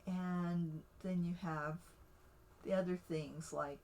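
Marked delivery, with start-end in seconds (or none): none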